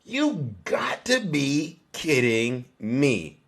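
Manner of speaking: retold shock, drawn out very slowly; stunned disbelief. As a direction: stretch each word out, recalling your disbelief. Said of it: "I almost passed out".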